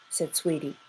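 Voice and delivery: usual speaking voice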